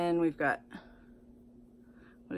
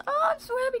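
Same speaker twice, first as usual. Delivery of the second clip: a sudden high squeal of joy; excited squeal; much faster than usual. Real, not acted. Thrilled to find Becca powder in the box, squealing. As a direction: squeal it out, delighted. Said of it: "Can you tell I'm happy?".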